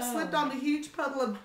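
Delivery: plain reading voice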